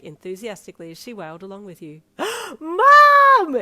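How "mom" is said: gasp, then high piercing joyful scream. A narrator's reading. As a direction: scream for Mum, overwhelmed. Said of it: "you screamed".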